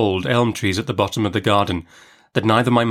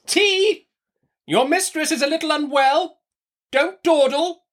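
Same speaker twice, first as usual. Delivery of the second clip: very high, peremptory commands; ordering servant. A narrator's reading. Aunt orders tea from servant down passage, peremptory. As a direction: call out orders, pitched right up.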